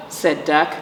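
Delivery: ordinary delivery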